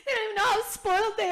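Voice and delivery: fast, high, loud playful wail; hammed-up weepy complaint; mock tearful. Mom joining in about lucky kids, mock tearful.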